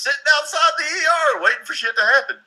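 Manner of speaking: exasperated emphasis. Real, not acted. Venting about festival patients at full hospitals, exasperated high pitch. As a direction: voice climbing high and staying there.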